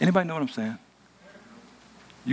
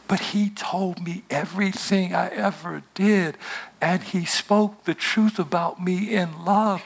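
slow heartfelt close; 7 semitones higher, slower than usual. Acted. Sermon climax, slow.

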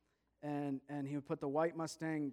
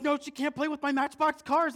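put-on high, loud child's objection; panicked protest; 11 semitones higher, 13 dB louder than usual. Boy diving to protect his cars, panicked protest. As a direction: protest loudly, desperate to save the toys.

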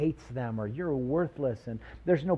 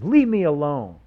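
a louder hint of a sullen dismissal; mock brush-off; 3 semitones higher, 9 dB louder than usual. Preacher voicing someone who hates God. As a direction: push people away.

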